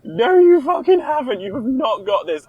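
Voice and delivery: scornful biker shutting down the boast; old biker man. Retold or imitated. Host redoing the retort as an old biker, high and laughing. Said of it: "I'm an old biker man".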